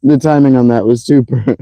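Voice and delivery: normal talking voice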